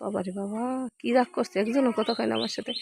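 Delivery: low, soft muttering to herself; quiet aside. Real, not acted. To herself about the sulking cow, soft mutter. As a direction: mutter the complaint under your breath.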